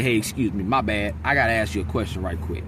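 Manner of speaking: imagined adult politely cutting into a conversation, low mock-polite; polite interrupter. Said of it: "and say".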